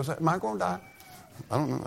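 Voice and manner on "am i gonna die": offhand, softer, almost shrugging; casual question. Retold or imitated. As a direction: ask it like it's nothing. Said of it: "asked me real kinda casual".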